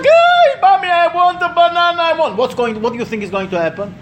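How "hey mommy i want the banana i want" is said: put-on high, louder whining kid; high childish whine for one more banana; whiny child. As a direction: beg like a spoiled little boy.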